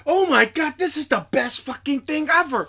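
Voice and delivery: put-on excited little kid. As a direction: gush like a thrilled six-year-old. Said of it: "a little six-year-old kid be like".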